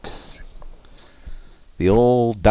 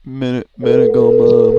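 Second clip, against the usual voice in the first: belted, higher ad slogan; loud slogan; 6 semitones higher, 8 dB louder than usual. Pretend. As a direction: belt it out like a commercial. Louder echo of the slogan.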